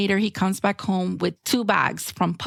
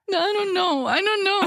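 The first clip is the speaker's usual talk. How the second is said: put-on high-pitched whiny child voice; mock-childish weepy protest; like a five-year-old; 10 semitones higher than usual. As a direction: act a tearful little kid who won't decide. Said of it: "like a five-year-old".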